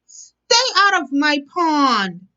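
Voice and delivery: a squabbling frog yelling; yelled. Acted. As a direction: yell it at the others from your pond. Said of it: "yelled Milton".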